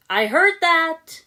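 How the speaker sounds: loud, high, drawn-out offended yell; offended shout. Acted. Cole yelling from elsewhere on the ship, offended. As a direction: shout it from the next room, stung. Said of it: "Cole shouted ... sounding very offended".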